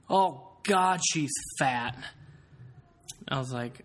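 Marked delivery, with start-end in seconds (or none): 0.1-2.0 put-on higher, louder drunk voice on the "Oh, God"; lightly voiced rude drunk; drunk remark. Mimicking drunk friend in car mocking a stranger, lightly voiced. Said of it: "I just hear him go".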